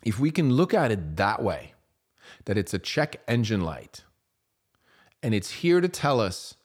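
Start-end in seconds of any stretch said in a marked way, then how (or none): none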